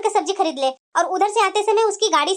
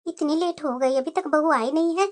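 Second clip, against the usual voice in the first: low, soft fretting to oneself; worried self-talk; 7 semitones lower, 5 dB quieter than usual. Acted. Mother-in-law waiting alone for daughter-in-law, quietly worried. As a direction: think aloud quietly, uneasy about the delay.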